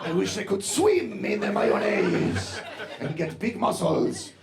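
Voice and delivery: put-on slow, rapturous dad in a thick Spanish accent; heavy Latin American accent. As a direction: savor every drawn-out word with a hammed-up Latin accent. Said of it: "very heavy Latin American accent".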